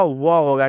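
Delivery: व as an open "va"; व as the sound of letter V; Hindi sound for V. Shown as a demonstration. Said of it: "भी का उच्चारण क्या होगा".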